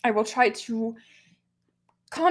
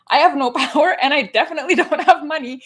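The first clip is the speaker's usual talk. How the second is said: louder, laughing through the self-quote; laughing exasperation; 4 semitones higher, 7 dB louder than usual. Retold or imitated. Streamer quoting his own thoughts to viewers, laughing loudly. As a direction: laugh it off, half-incredulous. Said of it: "And I'm like".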